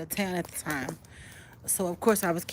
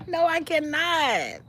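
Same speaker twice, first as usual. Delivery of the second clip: emphatic refusal; 10 semitones higher, slower than usual. Real, not acted. Refusing viewer request, laughing.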